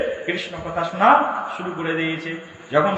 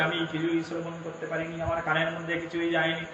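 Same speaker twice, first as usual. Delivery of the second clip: sheepish admission; 9 dB quieter than usual. Acted. Parvati admitting she heard nothing.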